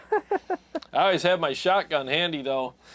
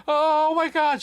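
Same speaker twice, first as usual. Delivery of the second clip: high, loud imitation of panic; freaking out; 12 semitones higher, 5 dB louder, slower than usual. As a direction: wail it in mock terror. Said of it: "She was, like, freaking out".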